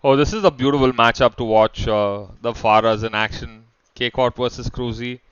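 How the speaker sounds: esports caster calling the fight; energetic shoutcaster commentary; live play-by-play. Acted. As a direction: call the action as it unfolds.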